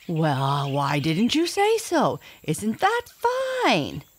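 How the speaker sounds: a pleased big cat drawling; tiger voice. Acted. Tiger answers the father.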